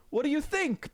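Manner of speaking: high, friendly gatekeeper voice; Saint Peter. Acted. Saint Peter welcoming Waffle to heaven, high and friendly.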